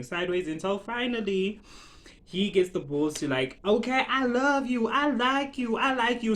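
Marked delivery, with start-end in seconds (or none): 3.6-6.3 mild mock scream, raised pitch; a higher-pitched declaration, not truly screamed; retold scream. Man declares his love from a bridge, mock yelling. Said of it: "screaming from the bridge".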